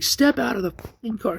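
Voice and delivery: high-pitched retold police command; heated order. Officer ordering man out of car, heated. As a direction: bark the order, voice jumping high.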